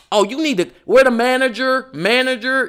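high, loud sassy shout for the manager; ladies demanding the manager. Retold or imitated. Retelling shoppers demanding the manager, high and loud. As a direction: pitch it way up and call for the manager.

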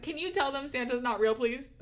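high, loud pleading to an adult; insistent mock-child request; retold child's appeal. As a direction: imitate an exasperated kid asking teacher for backup.

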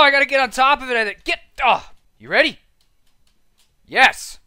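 ordinary talk, nothing marked

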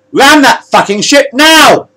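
slow, high, full-volume order; furious yell. A narrator's reading. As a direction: yell each word at the pilot. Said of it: "yelled the captain".

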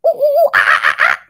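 high loud monkey hoots breaking into laughter; monkey call. Pretend. Teacher doing her favorite monkey call, high and laughing. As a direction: hoot like an excited monkey. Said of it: "they say".

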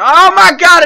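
loud, high cry of disbelief; fed-up outburst; exasperated shout. Real, not acted. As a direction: yell it in dismay. Another hated jump appears.

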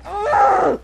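loud, high growl of frustration; frustrated groan. Real, not acted. Player flustered mid-fight, frustrated groan. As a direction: let out an exasperated "ugh".

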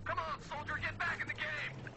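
drill sergeant. Pretend. Pep talk to self.

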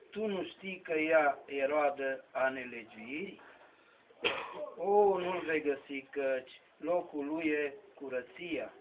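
solemn verse declaimed in measured phrases; poem recitation. Acted. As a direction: declaim sacred poetry, pausing between the lines.

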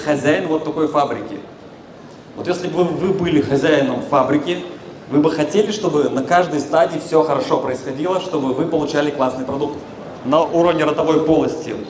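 normal talking voice

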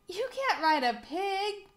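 high-pitched storybook objection; exclaim, voice jumping up; protesting cry. A narrator's reading. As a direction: cry out in disbelief. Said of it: "cried Lasso Lou".

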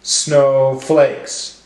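snow... flakes, in two beats; broken into syllables. Shown as a demonstration. Teacher helping class count syllables, one beat each. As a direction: separate the two syllables evenly. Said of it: "break up our words into syllables".

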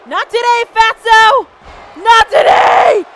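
very loud, high battle cry; full-volume defiance, last words stretched; defiant shout. Real, not acted. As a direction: roar your refusal at the opponent. Defying game opponent.

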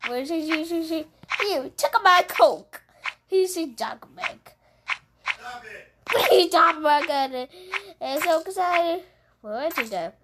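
normal talking voice

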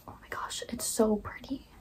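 whispered, breathless admiration; awed whisper. Retold or imitated. Telling viewers about seeing the red dress, whispered. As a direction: hush it like you can't believe how pretty.

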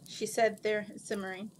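ordinary delivery

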